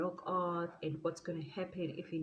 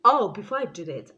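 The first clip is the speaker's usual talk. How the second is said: ordinary talk, nothing marked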